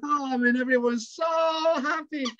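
high-pitched delighted exclamation; overjoyed. A narrator's reading. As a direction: cry it out with storyteller's joy. Narrator celebrates happy ending.

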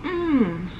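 ordinary talk

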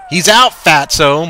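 loud, slow, spitting the insult; insulting retort. A narrator's reading. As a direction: drag out each word to sting. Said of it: "my coach retorted".

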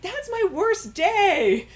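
high, loud, slow protest; pleading protest. Pretend. Person judged by one bad day protests, loud. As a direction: beg them to look past this day.

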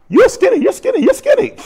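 very high, loud squeaky warning; comic high-pitched rapid repetition; mock alarm. Imitating people's dream warnings, comic.

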